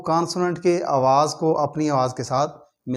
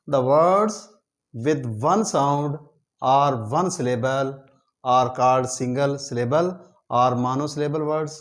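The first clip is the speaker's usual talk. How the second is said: slow dictation; much slower than usual. Shown as a demonstration. Reads English definition slowly.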